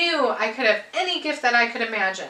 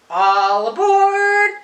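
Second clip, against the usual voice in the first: long, sung-out high call; 9 semitones higher, 7 dB louder, much slower than usual. A narrator's reading. Conductor calling children to board, sung-out high call. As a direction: sing it out like a train conductor. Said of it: "the conductor cried out".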